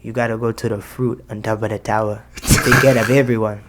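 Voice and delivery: put-on light slick Jamaican accent, not overdone; a smooth hint of a Jamaican old man accent. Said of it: "with a Jamaican accent".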